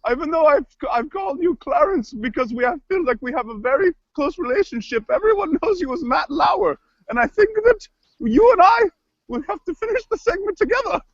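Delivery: theatrical, swooping plea; kooky cook character, pleading. Acted. Cook begs the co-host to stay, kooky voice. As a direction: coax in an affected cook voice. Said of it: "crazy voices".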